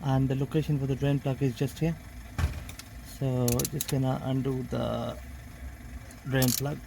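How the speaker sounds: talking while lying on his back; strained voice from under the car. Real, not acted. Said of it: "now that I'm under the car".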